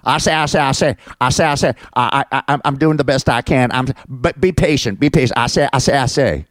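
high, stammering cartoon bluster; Foghorn Leghorn. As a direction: impersonate a blustering cartoon rooster. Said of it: "I call him Foghorn Leghorn".

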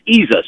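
"Jesus" pronounced like "ease us"; Jesus without the J. Shown as a demonstration. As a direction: say "Jesus" with no J, as EE-zus. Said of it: "If you take away the J".

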